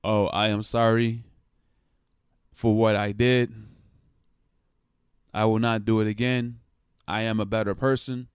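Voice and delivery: rehearsed sorry, read out without heart; canned apology. Acted. Player at press conference reciting an apology, robotic and stilted. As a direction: recite a stilted, monotone apology with long pauses. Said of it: "shouldn't sound it like".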